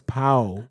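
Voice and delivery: "Pau" said to sound like "pow"; Spanish "Pau", rhyming with "cow"; Spanish pronunciation. Shown as a demonstration. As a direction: say "Pau" with an "ow" ending, no L. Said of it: "How do you say P A U in Spanish".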